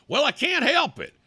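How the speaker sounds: high, exasperated self-defence; pissed off. Real, not acted. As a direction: protest heatedly, pitch way up. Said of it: "You know why I get pissed off?".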